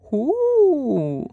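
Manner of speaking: high repeated owl hoot; owl asking again. A narrator's reading. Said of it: "Stare asked again".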